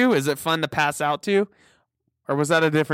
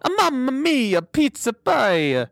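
hammed-up Italian accent, higher and slowed at the end; cartoon Italian accent, stretching pizza pie; mock Italian accent; 10 semitones higher, slower than usual. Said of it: "that's my Italian accent".

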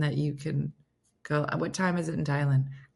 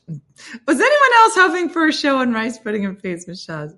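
high, loud mock announcer voice; hammed-up announcer; 7 semitones higher, 7 dB louder than usual. Vlogger hamming it up as an announcer for viewers. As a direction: sell the question like a showman.

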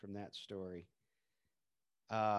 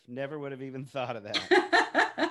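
laughing; 3 semitones higher, 9 dB louder than usual. Real, not acted. Amazed reply while laughing.

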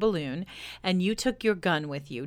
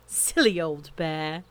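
ordinary delivery, nothing marked

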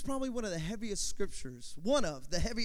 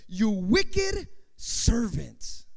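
drawn-out, louder rebuke of a servant; stern condemnation; 5 dB louder, much slower than usual. Acted. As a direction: condemn them slowly, stretching each word. Master rebukes servant in scripture.

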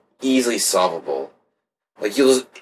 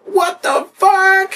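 high, drawn-out mock alarm; hammed-up startled squeal; mock shocked cry; 4 dB louder, much slower than usual. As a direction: stretch it out in high-pitched fake shock. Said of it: "You're like".

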